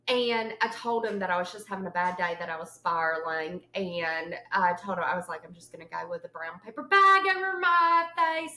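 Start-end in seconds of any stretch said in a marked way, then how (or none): 5.4-8.6 dramatic wail. Retold or imitated. Retells her spiralling complaint to friend.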